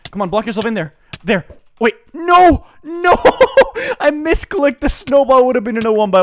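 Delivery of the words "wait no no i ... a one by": very high, loud cry of dismay; real high-pitched gamer outburst; anguished yelling. Real, not acted. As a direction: wail at your own blunder. Anguished reaction to a misclick.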